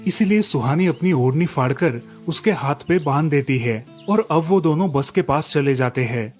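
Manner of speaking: usual speaking voice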